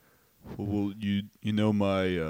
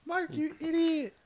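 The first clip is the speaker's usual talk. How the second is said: squeaky son character; 3 dB quieter than usual. Acted. Son scolding Mark, squeaky falsetto. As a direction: squeak it out like a comic child. Son Matthew in improvised scene.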